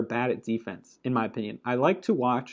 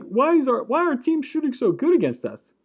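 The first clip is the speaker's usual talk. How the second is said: whiny fan voice; 11 semitones higher, 4 dB louder than usual. Retold or imitated. Blazer fans asking, retold.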